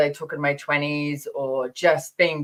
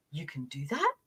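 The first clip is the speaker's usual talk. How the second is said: incredulous; 3 semitones lower, 10 dB quieter than usual. Retold or imitated. Imitating incredulous people.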